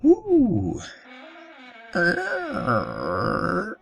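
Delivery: high-pitched imitated door creak; creaking door noise.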